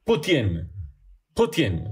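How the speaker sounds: "Putin" as PÚ-tyin, stressed U, softened t; Russian-style Putin. Shown as a demonstration. Host showing Russian pronunciation, "Putin" stressed on the U. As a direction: say "Putin" separately each time, stress on the U. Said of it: "con acento en la U".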